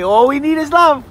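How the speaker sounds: high and loud, sing-song; sing-song prompt to child. Shown as a demonstration. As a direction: model it brightly for her.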